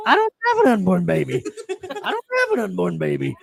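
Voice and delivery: mock indignant reply; Corey's own flat protest, lightly mimicked; imitating Corey. Friend retelling Corey's answer to the homeless guy, mock indignant. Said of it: "Just like that".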